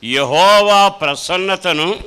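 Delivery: loud, fervent scripture repeat; preaching at full force. Acted. Preacher echoing the psalm reading to the congregation, loud and fervent. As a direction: proclaim the verse back with fervour.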